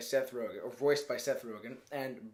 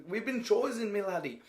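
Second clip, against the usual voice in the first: higher-pitched, badly faked Irish lilt; hammed-up Irish brogue, pitched up; terrible Irish accent; 7 semitones higher than usual. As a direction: put on a bad Irish accent. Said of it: "that was terrible Irish".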